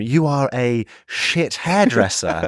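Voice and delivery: slow, spelled-out mock translation; mock slow explaining. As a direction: say it word by word to someone who doesn't understand. Mocking slow message to barber.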